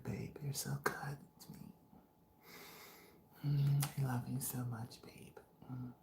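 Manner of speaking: sweet mushy whisper. Retold or imitated. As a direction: coo it in a whisper. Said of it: "imitating her".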